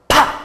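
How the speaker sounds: sudden loud vocal pop; abrupt percussive bang noise; mouthed gunshot pop. Acted. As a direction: imitate a gunshot, short and explosive.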